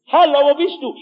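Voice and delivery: high, loud searching call; calling voice of Jesus. Acted. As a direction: call out to the lost. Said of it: "man hört die Stimme Jesu". (als Stimme Jesu laut rufend)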